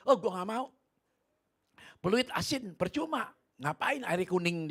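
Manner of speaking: high, loud scornful refusal; scoffing friend. Retold or imitated. Friend scoffs at the salty water, high and loud. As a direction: brush it off with mockery. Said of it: "dia bilang".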